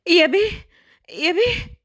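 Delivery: breathless, high-pitched calls to the master; panicked nanny calling. Acted. Nanny bursting in on the master, panicked calling. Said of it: "طلعت تجري بكل لهفة وهي مخضوضة".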